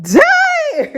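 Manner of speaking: squeaked, stunned damn; shocked high damn. Retold or imitated. Ronica stunned by the nurse's call, in a shocked, high-pitched voice.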